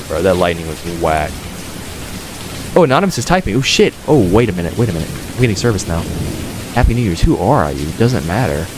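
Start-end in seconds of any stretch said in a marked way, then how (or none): none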